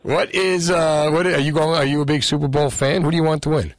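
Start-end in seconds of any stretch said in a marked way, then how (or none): none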